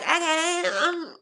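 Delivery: high-pitched mock-eager agreement; gullible Libra. Reader acting Libra accepting a suitor, high mock-coy voice. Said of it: "Honey, you're like".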